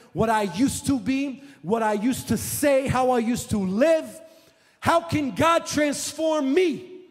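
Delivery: loud, high testimony preaching; full-force preaching. Acted. Preacher testifying about his own change, loud and fervent.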